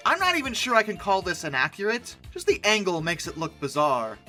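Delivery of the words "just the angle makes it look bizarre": ordinary talk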